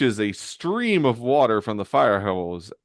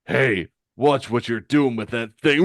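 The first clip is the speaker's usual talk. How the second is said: loud warning in a character voice; villain calling out a warning; Mad Dog; 3 semitones higher, 5 dB louder than usual. Acted. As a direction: shout a rough-and-ready villain's warning. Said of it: "And Mad Dog says".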